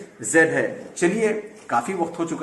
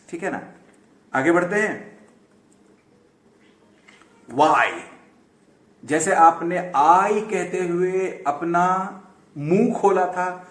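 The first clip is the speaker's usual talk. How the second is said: ordinary talk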